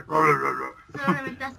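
very low, slow, growling; deep monster voice. Pretend. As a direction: drag it out like a beast. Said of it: "Tiene una voz bien gruesa". (voz gruesa de monstruo, lenta)

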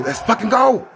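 loud high cheer; hype shout. Real, not acted. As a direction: yell it with joy. Hype reaction.

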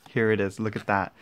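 normal talking voice

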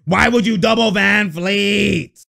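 slow, loud, exasperated yell; frustrated shout. Real, not acted. Fan yelling at the team's defense, slow angry shout. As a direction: bellow the question at the TV.

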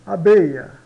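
"Abeia" dito alto e claro, ILL como A; loud model word. Shown as a demonstration. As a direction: professora modela "abeille" em voz alta. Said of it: "vamos estudar repetindo". ("Abeia" pronunciado forte e claro)

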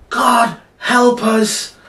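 high, loud cry for help; panicked cry. Acted. Sailor cries to God in the storm, panicked shout. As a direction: cry out in acted alarm. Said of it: "cried a sailor".

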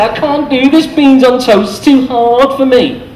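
mock pitiful whine; helpless victim. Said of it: "and they say".

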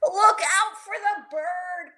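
loud, high, drawn-out holler; storybook kid hollering; hollered warning. A narrator's reading. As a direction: call out an urgent warning. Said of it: "Pinkalicious Peter hollered".

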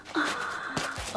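sharp delighted gasp; surprised gasp. Real, not acted. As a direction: gasp at the sight. Sees bag turn pink.

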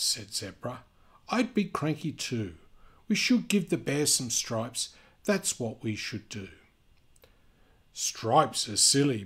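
lively storybook reading voice; animated read-aloud for kids; reading to children. A narrator's reading. Reading picture book aloud.

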